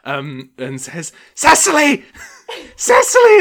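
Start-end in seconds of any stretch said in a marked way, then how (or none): 1.3-3.4 high, loud call across the crowd. Acted. Old man shouting into the crowd for his wife. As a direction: call your wife from afar. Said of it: "shouts back into the crowd".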